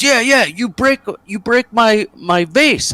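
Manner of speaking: high, agitated mock foreigner's outburst; yelling bar owner. Bar owner accuses him over the vase, mock angry yelling. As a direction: play an irate German accusing a customer. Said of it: "yelling at us in German".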